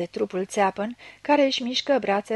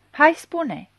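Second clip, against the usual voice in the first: urgent demand; 6 semitones higher, 5 dB louder than usual. Acted. Blind man urges confession.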